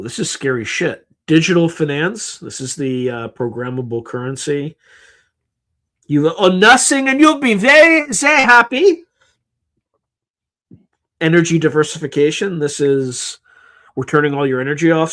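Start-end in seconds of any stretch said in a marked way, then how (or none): 6.1-9.0 much higher, louder, sugary mock of a smug globalist; mocking elite voice. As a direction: parody the elite promise, sing-song and sarcastic. Mocking the famous elite slogan.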